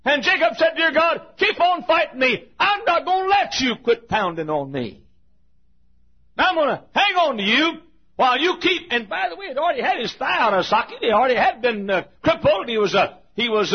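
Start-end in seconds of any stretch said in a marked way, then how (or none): 0.8-8.9 higher, shouting plea to God; fervent cry. Retold or imitated. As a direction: cry out holding on, refusing to quit. Jacob pleading while wrestling.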